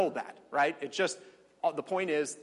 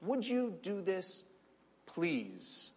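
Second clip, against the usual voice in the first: gentle request; 4 semitones higher, 6 dB quieter, much slower than usual. Acted. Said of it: "It's just a request".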